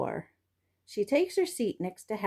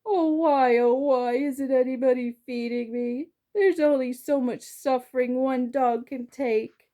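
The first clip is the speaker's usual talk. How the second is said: high, hammed-up mournful moan; milk the suffering like a drama queen; moaning lament; 4 semitones higher than usual. A narrator's reading. Dog on his back moaning to his humans, hammed-up lament. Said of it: "roll onto my back and moan".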